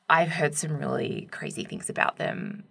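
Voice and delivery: hushed, secretive warning about the class; confiding whisper. Retold or imitated. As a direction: lean in and whisper it like gossip.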